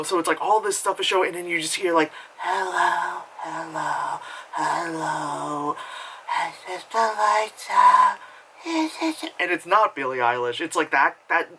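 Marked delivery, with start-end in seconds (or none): 2.4-9.3 mocking a breathy, raspy indie singer; breathy, raspy, drawn-out mock singing; whispery raspy cover. Vlogger mocking a trendy cover singer, whispery and raspy. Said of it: "a whispery, raspy version of Smells Like Teen Spirit".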